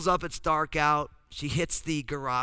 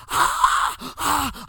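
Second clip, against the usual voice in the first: mock scream, much higher and louder; retold scream; 11 semitones higher, 9 dB louder than usual. Husband imitating his wife screaming at a mouse. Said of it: "I hear Lynn start screaming".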